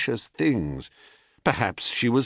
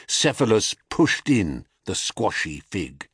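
usual speaking voice

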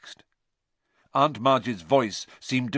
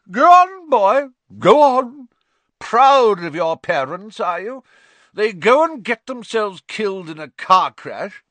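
high, loud, taunting aunt voice; tipsy goading aunt; 11 semitones higher, 9 dB louder than usual. A narrator's reading. Aunt taunting orphan about his parents, goading. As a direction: goad the boy with drunken relish.

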